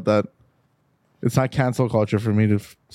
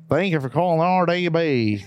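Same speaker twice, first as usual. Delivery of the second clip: higher-pitched imitation of a coworker's accent; Hank's accent; 5 semitones higher than usual. Joking about a coworker answering the shop phone, imitated accent. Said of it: "I'm making his accent".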